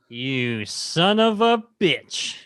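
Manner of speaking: very slow, drawn-out growl; imitating Dutch. Quoting the famous movie greeting as a drawn-out tough-guy growl. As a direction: stretch every word like an action hero. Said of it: "the famous".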